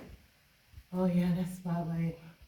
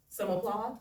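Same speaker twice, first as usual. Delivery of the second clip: usual speaking voice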